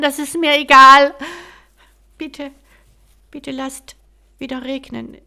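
a prince crying out to the fairy for rain; desperate outcry. Acted. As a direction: beg the fairy with everything you have.

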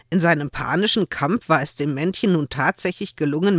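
plain reading voice

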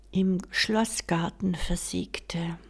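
ordinary delivery, nothing marked

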